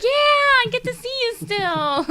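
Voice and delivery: high, loud, drawn-out cheer; excited greeting. Retold or imitated. Coworker replaying her reply to a regular, excited.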